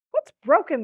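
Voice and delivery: sudden high, loud demand; queen snapping. A narrator's reading. Queen confronting her daughter about something broken, snapped demand. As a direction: snap the question out sharply. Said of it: "the queen snapped".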